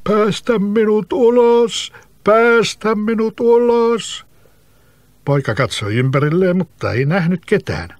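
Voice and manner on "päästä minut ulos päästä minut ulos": high, slow hollow plea from underground; eerie trapped-spirit voice pleading slowly. Acted. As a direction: call up muffled from beneath the roots. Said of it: "kumealla äänellä sanoi".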